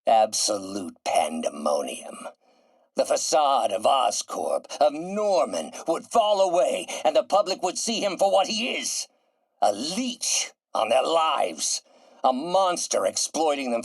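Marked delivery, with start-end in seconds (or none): 4.8-9.1 higher-pitched, mounting righteous rant; urgent, high-pitched contempt; rising indignation. Acted. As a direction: build into an impassioned denunciation. Villain rants about his enemy.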